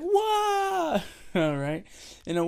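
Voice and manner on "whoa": very high, drawn-out whoa; a child amazed at the volume changing. Retold or imitated. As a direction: gasp it like a wonderstruck kid. Kid amazed volume moved.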